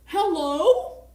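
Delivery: calling out. Real, not acted. Calling out to new arrivals.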